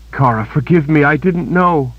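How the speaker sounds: a touch of tearful anguish; anguished apology. A narrator's reading. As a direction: beg forgiveness in guilt. Kalen apologises to tortured friend.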